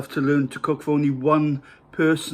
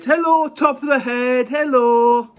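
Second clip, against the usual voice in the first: silly high greeting; 8 semitones higher, 4 dB louder than usual. Pretend. Greeting the top of a head.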